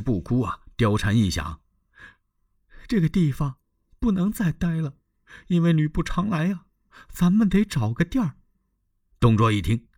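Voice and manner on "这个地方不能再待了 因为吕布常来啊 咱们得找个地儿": Diaochan's desperate vow. A narrator's reading. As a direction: threaten to die, high and pleading. Diaochan plotting to leave. (貂蝉以死相逼，声音高而柔)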